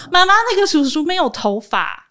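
high, loud innocent announcement to Mom; blurting child. Retold or imitated. Child in taxi back seat announcing driver is bald, blurting. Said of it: "小孩就在后座直接说".

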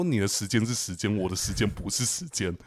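a quiet, mild grumble to herself; half-voiced resentful aside, kept down; muttered inner thought. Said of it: "我就冒出几句话".

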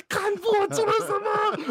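high, loud, slow anguished cry with laughter; mock anguish. At the apology, crying out in hammed-up guilt. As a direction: wail it in exaggerated guilt.

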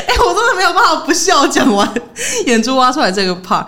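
high, giggling apology; real laughter, voice up high; can't stop laughing. Real, not acted. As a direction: laugh through the words helplessly.

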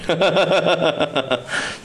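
long chuckle tailing off; laugh. Real, not acted. Storyteller saying the place is haunted, chuckling. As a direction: laugh it out, falling away. Laughs after ghost remark.

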